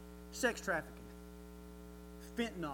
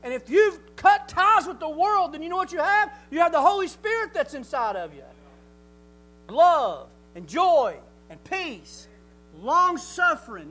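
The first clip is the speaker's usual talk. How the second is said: slow emphatic preaching; 4 semitones higher, 7 dB louder, slower than usual. Acted.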